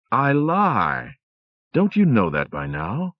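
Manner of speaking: high mocking devil voice; gleeful devil. A narrator's reading. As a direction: taunt her with wicked glee.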